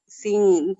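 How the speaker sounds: ordinary talk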